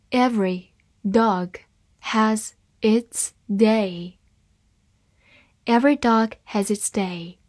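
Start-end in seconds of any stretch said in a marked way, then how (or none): 0.1-4.2 slow, word by word, each word clear; slow reading. Shown as a demonstration. Teacher reads the proverb slowly to students. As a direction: read it slowly for learners. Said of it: "好，慢读一遍。".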